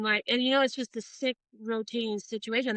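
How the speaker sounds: ordinary talk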